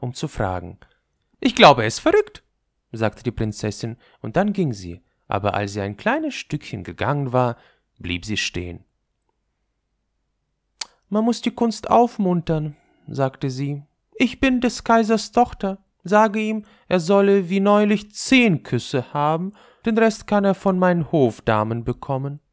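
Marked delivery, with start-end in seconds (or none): 1.4-2.4 hoch und laut empört; entrüstet wie eine Prinzessin; empörte Prinzessin. A narrator's reading. Prinzessin empört über Forderung. 11.1-12.8 hoch, gnädig herablassend; hohe Prinzessinnenstimme. A narrator's reading. Prinzessin überlegt es sich. 14.1-22.4 hoch und laut, befehlend; hochmütige Prinzessin. A narrator's reading. Prinzessin befiehlt.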